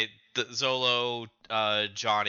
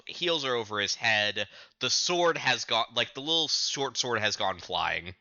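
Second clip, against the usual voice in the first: usual speaking voice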